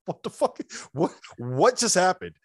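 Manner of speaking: mock stunned, pitch shooting up; bewildered reaction to the coach's outburst; stunned disbelief. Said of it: "we're just sitting here like".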